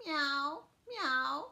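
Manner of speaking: playful kitty meow; cat meow. Pretend. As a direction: mew like a cat asking. Cat page, cat sound.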